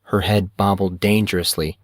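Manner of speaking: usual speaking voice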